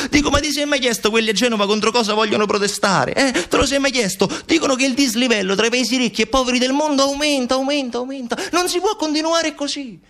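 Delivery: high, impassioned outburst; indignant Montalbano. Acted. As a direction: plead urgently against the world's injustice.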